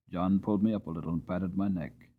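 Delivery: ordinary delivery, nothing marked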